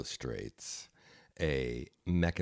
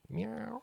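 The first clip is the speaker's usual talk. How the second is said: cat meow; 7 dB quieter than usual. Pretend. Eating the cat cookie's head.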